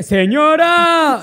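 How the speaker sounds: high, slow, drawn-out "señora". Shown as a demonstration. Mocking the period as a very proper lady, stretching "señora". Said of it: "es muy seño".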